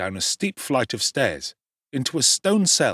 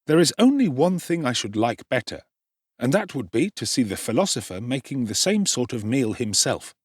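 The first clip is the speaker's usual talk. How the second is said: usual speaking voice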